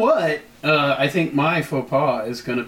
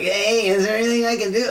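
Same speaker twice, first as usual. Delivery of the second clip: higher-pitched put-on impression of a colleague; imitating someone offering help, voice raised in pitch; Sammy voice; 6 semitones higher than usual. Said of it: "that's my Sammy, my Sammy voice".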